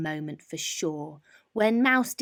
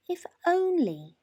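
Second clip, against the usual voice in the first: 1 mouse voice; 9 semitones higher than usual. A narrator's reading. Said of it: "thought Mouse".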